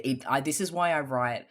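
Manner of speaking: ordinary delivery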